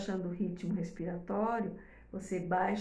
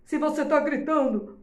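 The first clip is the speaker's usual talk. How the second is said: higher and louder, a sample yell; mock shouting; 5 semitones higher, 7 dB louder than usual. Shown as a demonstration. Teacher showing mothers what a shouting tone sounds like. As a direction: shout it as a quick demo. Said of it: "ela vai entender o tom de voz".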